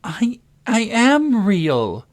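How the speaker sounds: high, drawn-out wail; loud, high-pitched whine of distress; tearful protest. A narrator's reading. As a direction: protest slowly on the edge of tears. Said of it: "and began to cry".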